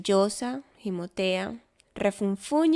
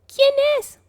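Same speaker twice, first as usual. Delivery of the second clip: very high, loud squeaky cry; a tiny mouse calling out in alarm; mouse shouting; 10 dB louder than usual. A narrator's reading. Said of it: "grita ratón".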